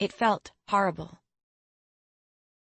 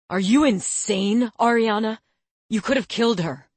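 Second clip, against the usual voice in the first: angry confrontation; 3 semitones higher, 6 dB louder than usual. A narrator's reading. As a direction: shout at her in disbelief. Lucian confronts Ariana angrily.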